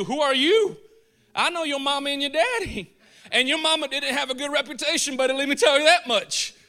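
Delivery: loud, high taunting jeer at Jesus; indignant crowd jeering at the preacher from Nazareth; mocking crowd taunt. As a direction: throw the insult at him like a heckler. Preacher voices the angry crowd.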